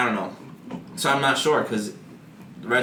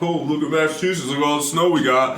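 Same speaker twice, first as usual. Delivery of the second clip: put-on boastful local voice, raised; a snooty Masshole showing off; mock bragging local; 4 semitones higher than usual. Imitating smug Massachusetts people bragging about snow, in a put-on voice.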